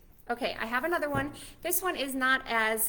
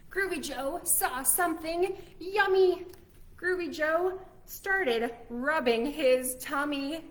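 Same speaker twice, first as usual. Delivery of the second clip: put-on lively storybook reading for little kids; reading to children; 2 semitones higher, slower than usual. A narrator's reading. Teacher reading a story to kids, lively. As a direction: read it like a picture book to a class. Reads picture book aloud to kids.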